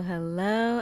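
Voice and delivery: usual speaking voice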